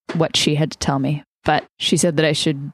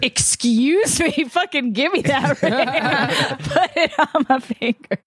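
excited laughing demand; 10 semitones higher than usual. Acted.

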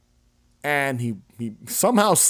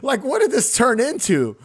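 mock shocked kid; 8 semitones higher than usual. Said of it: "I was like".

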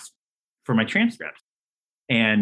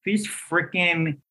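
mock-outraged, drawn out and slow at first; indignant; 3 semitones higher, slower than usual. Applicant fuming inwardly at the NYU callers, indignant. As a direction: spit it out as an affronted applicant. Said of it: "I was so dumb".

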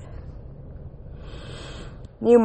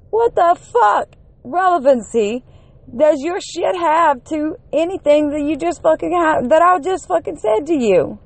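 furious raised voice, words spat out; outraged rant; 7 semitones higher than usual. Real, not acted. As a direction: yell it in disbelief at someone's stupidity. Ranting at twitter opponent.

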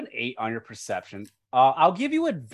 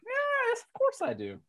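squeaky falsetto retort; playful high voice; 4 dB quieter than usual. Pretend. Game master insisting on rolling dice, squeaky mock-indignant.

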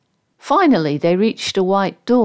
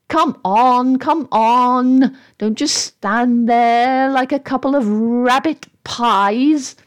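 higher, testy hurrying-along; impatient scolding; 5 semitones higher, slower than usual. Acted. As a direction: scold them to get a move on.

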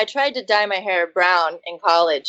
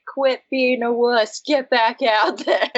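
slow, lightly voiced gruff dad; dismissive dad; 5 semitones higher, slower than usual. Dad telling daughter to keep cheering, lightly voiced dismissive. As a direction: brush her off as a dad. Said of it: "he was like".

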